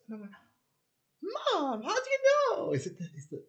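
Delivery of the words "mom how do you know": much higher, louder astonished cry; mock-shrieked how do you know; shocked outburst. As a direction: blurt it out in disbelief. Shocked question to mum.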